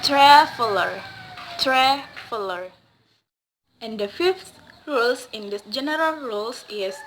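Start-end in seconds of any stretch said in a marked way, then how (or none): 0.0-2.7 traveler with TRA punched loud; stress on first syllable. Shown as a demonstration. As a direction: hit TRA in traveler, let the rest fall. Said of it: "word stress in the first syllables".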